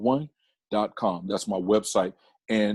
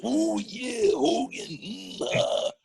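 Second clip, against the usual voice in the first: high, strained wrestler impression; Macho Man Randy Savage; 12 semitones higher, much slower than usual. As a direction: growl out a drawn-out wrestler catchphrase. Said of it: "imitating wrestlers like Macho Man Randy Savage".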